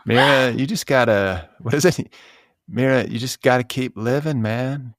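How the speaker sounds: put-on slow, lightly drawled Texas accent; lazy laid-back Texan drawl. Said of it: "The impression kinda sounds ... George W".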